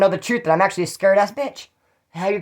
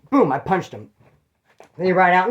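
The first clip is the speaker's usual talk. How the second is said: ordinary talk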